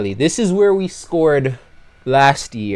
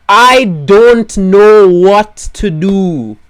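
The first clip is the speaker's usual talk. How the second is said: despairing wail; 7 semitones higher, 10 dB louder, much slower than usual. Real, not acted. Despairing over driver not scoring.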